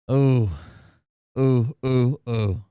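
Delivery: loud drawn-out wincing ooh; loud pained oohs. Real, not acted. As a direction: groan out each ooh. Reacting to the picture.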